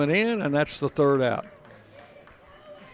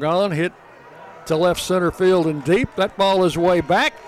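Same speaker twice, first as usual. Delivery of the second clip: rising, louder excited call; home run call; 4 semitones higher, 3 dB louder than usual. Real, not acted. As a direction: build it up as the ball carries out.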